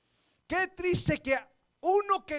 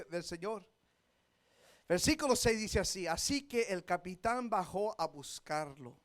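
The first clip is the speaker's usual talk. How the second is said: low, soft scripture reading; quiet sermon reading; 7 semitones lower, 7 dB quieter than usual. Acted. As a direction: read the verse gently to the congregation. Quiet preaching read. (lectura bíblica en voz baja)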